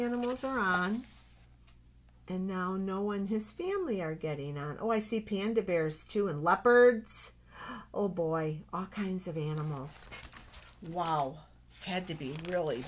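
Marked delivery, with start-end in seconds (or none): none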